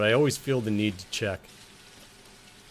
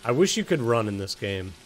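usual speaking voice

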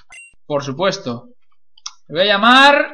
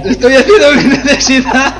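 laughing shout; 7 semitones higher, 9 dB louder than usual. Real, not acted. Yelled, laughing.

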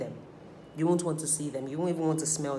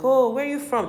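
mock friendly driver; 8 semitones higher, 5 dB louder than usual. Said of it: "the person's just like".

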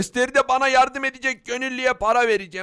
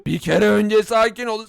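ordinary talk, nothing marked

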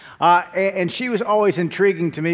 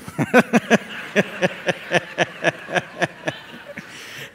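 breathy laugh; 2 semitones higher than usual. Real, not acted. Laughs at own joke.

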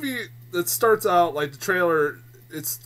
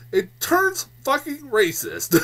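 slowed, higher, laughing through the words; laughing disbelief; 9 semitones higher, 3 dB louder, slower than usual. Real, not acted. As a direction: laugh out the outrage. Laughing rant about racist trailer.

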